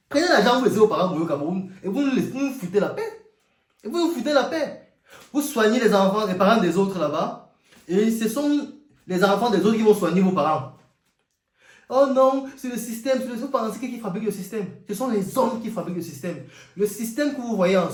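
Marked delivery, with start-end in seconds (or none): 0.1-1.7 loud, high sarcastic jab; mock the emigrants' excuse; mocking sarcasm. Mocking emigrants who stay abroad for money, high and loud. 1.8-3.3 laughing mockery. Mocking with laugh. 3.8-7.0 higher, sharper mocking repeat; high mocking jab. Mocking emigrants, pitched higher than the first take. 11.9-14.0 high loud mock protest. Imitating an emigrant's excuse, high and loud.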